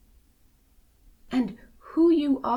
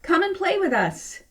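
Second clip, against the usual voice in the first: higher cheerful invitation; calling out; 7 semitones higher, 4 dB louder than usual. Acted. Fish inviting the rainbow fish to play, high cheerful call. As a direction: call him over happily. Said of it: "they called".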